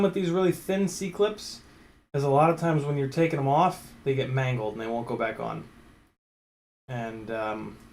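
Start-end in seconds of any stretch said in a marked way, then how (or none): none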